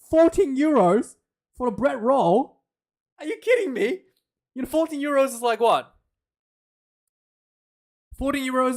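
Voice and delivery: high, loud, disbelieving rant over a price; incredulous outrage. Real, not acted. Streamer ranting at the commentary's bread roll price, high incredulous yelling. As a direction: rage at fourteen euros like it's robbery. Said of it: "'Cause there'll be more yelling coming".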